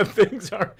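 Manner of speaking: high, loud burst of laughter through the words; laughing. Real, not acted. Host cracking up at his own joke, laughing hard. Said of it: "Oh my God".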